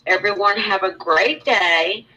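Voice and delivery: usual speaking voice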